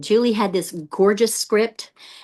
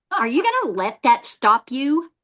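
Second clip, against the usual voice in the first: usual speaking voice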